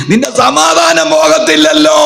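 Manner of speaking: preaching climax; fervent cry. Real, not acted. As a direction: ring it out at the crowd.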